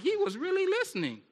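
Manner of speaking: high, soft mock amazement; amazed wife. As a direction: play a wife marvelling in a high voice. Wife amazed her husband listened.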